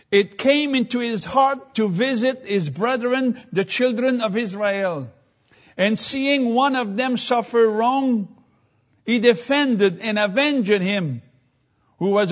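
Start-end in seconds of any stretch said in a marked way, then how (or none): none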